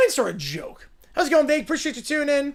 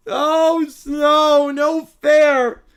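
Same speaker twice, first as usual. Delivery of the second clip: put-on louder whiny child cry; loud kid wail; 2 semitones higher, 6 dB louder, much slower than usual. Kids collapsed on the rug wailing, loud whine.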